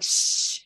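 long hissed shush; imitated shushing. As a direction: shush someone sharply. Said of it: "they're shushing me".

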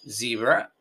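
"zebra" said the English way, ZEE-bra with buzzing z; English pronunciation. Shown as a demonstration. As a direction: say "zebra" in English, rising at the end. Repite la palabra inglesa. ("zebra" a la inglesa, con z sonora)

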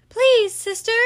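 high, loud plea; pleading Baby. Acted. Baby begging her sister not to call, high plea. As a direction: beg your sister to stop.

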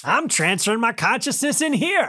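put-on high, triumphant old-man whoop; hammed-up high-pitched elation; gleeful old man avatar. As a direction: declare it in giddy ecstasy. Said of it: "there's an old man avatar".

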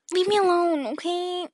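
exasperated, pushing people off; fed up. Retold or imitated. Voices wanting to be left alone.

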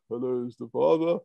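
lightly put-on dad voice; a hint of mock-grown-up phone voice; kid posing as father. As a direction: imitate a kid badly faking his father. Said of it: "he puts on the verse".

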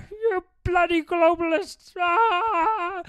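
high, loud crying rant; hammed-up weepy tirade; Jordan Peterson impression. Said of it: "there's Jordan Peterson".

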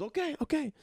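very high put-on voice; mock giving in. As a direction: concede in a comic high-pitched voice. Chef gives in, acted quote.

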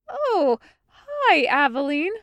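high, loud, panicky hi; panicked greeting. A narrator's reading. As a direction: greet her with forced panic cheer. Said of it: "a smile of sheer panic".

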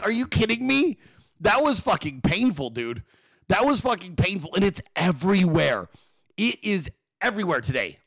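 ordinary talk, nothing marked

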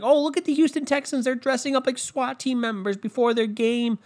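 high, gushing anchor voice; mocking SportsCenter host. Mocking SportsCenter gushing over Texans costumes, high and gushing. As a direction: gush like a giddy TV sports anchor. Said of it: "SportsCenter was like".

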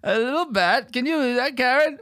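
high, dazed rambling rocker. Retold or imitated. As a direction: play the dopey old rocker, high. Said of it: "and he said".

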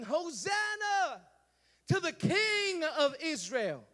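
slow, drawn-out high acclaim; crowd shouting. Acted. Crowd hailing the king on the donkey, slow cry. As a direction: hail him like thousands in the street. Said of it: "Thousands of voices shouting".

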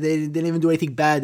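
ordinary talk, nothing marked

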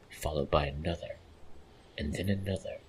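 plain reading voice

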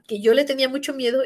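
ordinary talk, nothing marked

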